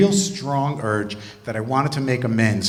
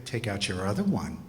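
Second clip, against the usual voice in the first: low, hushed snark; quietly snarky retort; 6 semitones lower, 11 dB quieter than usual. Retold or imitated. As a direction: deliver it low and soft, with snide understatement. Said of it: "I'd snarkily look at them and say".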